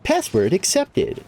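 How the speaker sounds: high, slow robot announcement. Retold or imitated. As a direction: chirp it out like a machine. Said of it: "and then it just goes".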